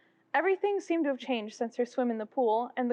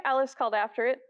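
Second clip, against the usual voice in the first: usual speaking voice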